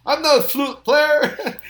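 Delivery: high, slow disbelief at the request; incredulous. Retold or imitated. Sax player asked for a flute solo, surprised and disbelieving. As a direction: balk in surprise, pitch jumping up.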